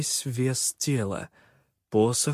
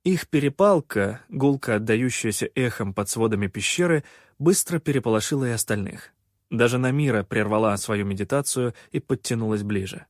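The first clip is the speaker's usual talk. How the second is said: ordinary delivery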